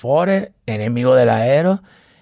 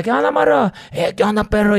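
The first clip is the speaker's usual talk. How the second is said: cocky street greeting, much higher; brash greeting; 7 semitones higher than usual. Retold or imitated. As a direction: shout a cocky hello, pitched way up. Brash greeting, pitch high.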